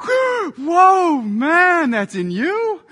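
loud, high-pitched pretend astonishment; mock shock. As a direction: blurt it out as if badly shocked, hammed up. Mock God shocked by sin.

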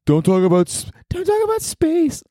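high falsetto woman's voice; squeaky high girly imitation; girl voice. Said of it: "What is my girl voice?".

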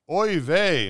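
loud high squeal of dismay. Acted. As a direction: squeal it out in horror. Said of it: "Missus Flegel squealed".

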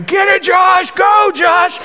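high, loud screaming cheer. Retold or imitated. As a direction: yell it from the balcony like teen girls. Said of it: "they're screaming".

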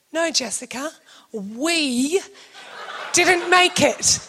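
slow, pointed, dry answer; deadpan reply. Retold or imitated. Husband telling wife they missed the flight, dry. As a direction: spell it out flatly. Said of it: "Luke looks at me and goes".

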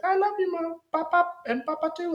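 high mock child voice; hammed-up high sugary Claudia; sweet little girl. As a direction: chirp it like a cute little girl. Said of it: "she's like".

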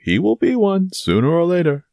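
high, insistent proclamation; emphatic cry. Acted. Porthos insists Aramis will be an abbé, crying out.

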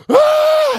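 very high, loud imitated scream; stuck-pig scream. Pastor imitating Billy screaming like a stuck pig. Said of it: "He's screaming like a stuck pig".

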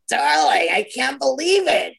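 high-pitched, thrilled cry of recognition; retold squeal of joyful surprise; delighted greeting. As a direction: greet a long-lost friend in amazed delight. Retold delighted backstage reunion.

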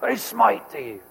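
lightly voiced movie line; a hint of the comedy smiting line; Bruce Almighty quote. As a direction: toss off the film quote casually. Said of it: "He goes".

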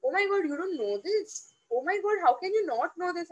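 swooping, mock-shocked questions; incredulous put-down. Experienced colleagues to the newcomer, incredulous and judging. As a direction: mimic colleagues' exaggerated disbelief. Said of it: "people have this typical way of saying it".

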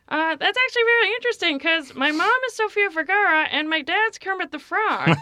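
high-pitched comedian impression; Melissa Villaseñor. Guest answers Katharine Hepburn's jab as her, high impersonated voice. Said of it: "And then Melissa goes".

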